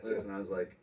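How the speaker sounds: ordinary talk, nothing marked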